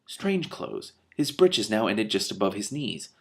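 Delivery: usual speaking voice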